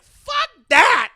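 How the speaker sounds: very loud, high-pitched shout; furious outburst. Retold or imitated. Boyfriend seeing her smile on the phone, furious outburst. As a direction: explode in indignation, shouting way up high. Acting out his furious reaction.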